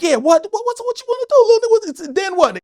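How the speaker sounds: high, loud taunting challenge. Retold or imitated. Comic squaring up on the corner, high loud taunt. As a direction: bark it at the little guy. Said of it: "on the corner like".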